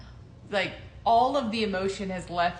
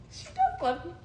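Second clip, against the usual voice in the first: giddy. Retold or imitated. Realizes her love is returned.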